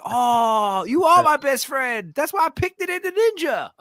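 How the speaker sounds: very high, loud hammed-up reassurance; mock shout. Comedian shouting a movie line back, very high and loud. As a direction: yell it out in a squeaky movie voice. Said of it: "He's like".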